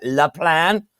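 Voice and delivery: lightly mimicked fake French accent; mock-French "Le Plan". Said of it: "she makes up that fake French accent".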